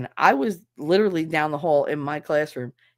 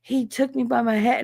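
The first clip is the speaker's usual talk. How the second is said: higher-pitched imitation of an excited boy; dramatic schoolboy retelling; kid acting it out; 6 semitones higher than usual. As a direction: act out the attack like a kid. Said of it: "act the whole thing out".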